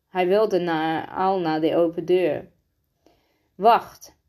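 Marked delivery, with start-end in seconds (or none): 3.5-4.2 sharp, louder one-word command; snapped. A narrator's reading. Rosa stopping Fico at the open door, snapped. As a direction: snap it to stop someone. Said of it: "snauwde Roze".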